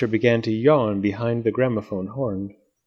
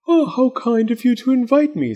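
high polite moth voice; courtly little moth; 12 semitones higher than usual. Acted. Moth speaks.

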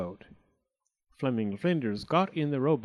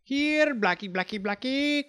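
crying out; 13 semitones higher than usual. A narrator's reading. Owner on shore calls his crow, crying out high. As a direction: call the bird in with a high cry. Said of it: "cried Signor Monteverde on shore".

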